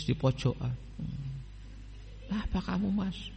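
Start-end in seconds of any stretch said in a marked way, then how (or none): 2.3-3.3 put-on higher, softer voice asking a crying boy; gentle question to child. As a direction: ask softly as if to a homesick kid. Teacher and crying new student. (bertanya lembut kepada santri yang menangis)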